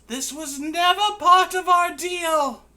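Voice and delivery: very high, loud, strange voice; Banty's voice. Acted. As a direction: squeal it in outrage. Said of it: "Banty says".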